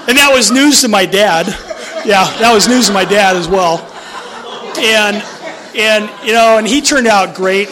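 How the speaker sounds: talking through real laughter, loud; words riding on laughter; laughing. Real, not acted. Speaker tells audience about dad and the news, laughing. Laughing over cousin-brother joke.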